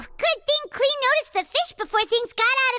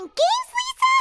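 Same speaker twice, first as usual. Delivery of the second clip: sharply higher, surprised Lisa?; surprised question; 13 semitones higher than usual. Acted. Paimon asking Lisa in surprise, sharply higher. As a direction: catch her name in disbelief.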